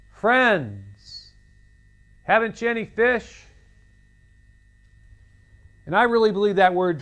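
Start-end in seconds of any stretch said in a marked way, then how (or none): 0.1-3.4 calling out. Retold or imitated. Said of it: "He called out to them".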